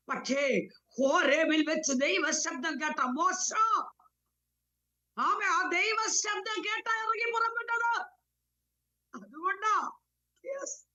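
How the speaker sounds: high-pitched, straining sermon climax; impassioned preaching. Real, not acted. Preaching rises at key point. (ഉയർന്ന സ്വരത്തിൽ ആവേശഭരിതമായ പ്രസംഗം)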